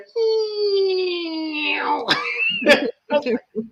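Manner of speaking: long, very high mock wail breaking into laughter; high drawn-out squeal. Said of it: "I just went".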